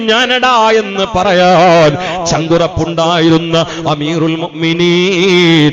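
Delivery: a drawn-out, rousing sermon chant; full-force preaching. Acted. Chanted sermon tells Umar story.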